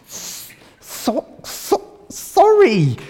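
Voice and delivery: high, strained sorry forced out; struggling to say sorry. Shown as a demonstration. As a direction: act out struggling to get the word out. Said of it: "You don't want to say it, do you?".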